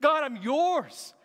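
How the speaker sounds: loud, high acted cry to God. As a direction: act out a drawn-out, surrendering cry. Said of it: "he cries out to him".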